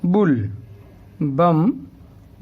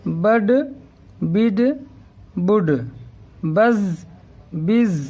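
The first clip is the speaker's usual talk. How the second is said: steady classroom recitation of each syllable three times; syllable drill; much slower than usual. Shown as a demonstration. Teacher reciting syllables for pupils to repeat.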